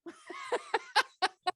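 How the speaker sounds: high rueful laugh. Real, not acted. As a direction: burst out laughing at yourself. Laughs at losing money.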